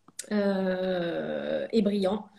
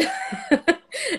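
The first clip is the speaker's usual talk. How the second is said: burst of laughter. Real, not acted. Host asks for a piece.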